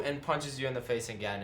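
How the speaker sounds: ordinary talk, nothing marked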